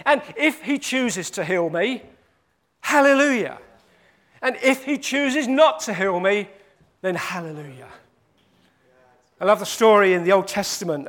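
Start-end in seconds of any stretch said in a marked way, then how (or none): 2.8-3.6 higher, louder hallelujah of praise; joyful hallelujah. Acted. Preacher praising God for a healing, joyful. 7.0-7.9 lower, softer, settled hallelujah; quiet hallelujah. Acted. Preacher trusting God without healing, quiet.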